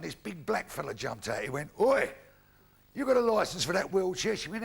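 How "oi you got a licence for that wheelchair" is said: loud, high "Oi" challenge; challenging call-out. Acted. Man jumps out at old woman in wheelchair, calling loudly. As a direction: call out to stop someone, acted.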